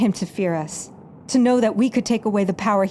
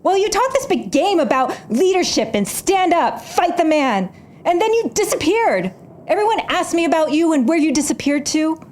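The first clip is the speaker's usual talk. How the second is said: bitter accusation; 6 semitones higher than usual. Acted. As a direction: throw every grievance back at him. Quarrel with former mentor.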